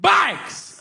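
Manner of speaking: high, loud one-word outburst; sudden yell. Shown as a demonstration. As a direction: blurt it out like a verbal tic. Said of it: "I'll just yell shit out".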